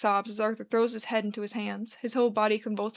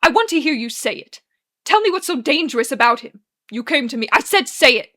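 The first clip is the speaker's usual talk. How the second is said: loud, raised-pitch insistence; angry demand; 3 semitones higher, 9 dB louder than usual. Acted. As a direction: push for an answer, getting louder. Acted quarrel, Arthur demands answer.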